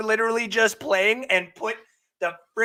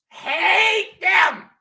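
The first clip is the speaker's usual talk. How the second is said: Hate them, yelled much higher and louder; shouted hate; 8 semitones higher, 7 dB louder than usual. Shown as a demonstration. Ranting at the Saints to his stream, yelling. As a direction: blow up on the word hate. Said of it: "I hate them".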